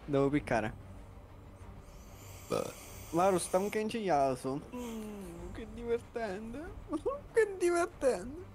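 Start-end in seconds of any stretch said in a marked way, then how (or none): 4.7-6.8 softer, slow deadpan sarcasm; sarcastic. Real, not acted. Sarcastic reaction during game. 6.9-8.4 higher, slow, through laughter; laughing. Real, not acted. Repeats it laughing.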